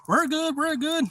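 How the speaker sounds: high, loud, buoyant dismissal; excited reassurance. Retold or imitated. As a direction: brush him off while still thrilled.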